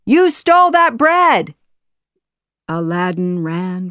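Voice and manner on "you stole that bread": high, loud accusing yell; angry guard yelling; guards' shout. A narrator's reading. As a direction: shout the accusation after a thief. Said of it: "the guards shouted".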